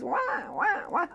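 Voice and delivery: soft high mock baby wail "wah wah"; gentle imitation of a crying infant. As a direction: imitate a newborn's thin cry, quietly. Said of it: "When the baby goes".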